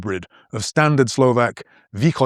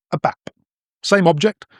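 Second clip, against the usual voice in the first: ordinary talk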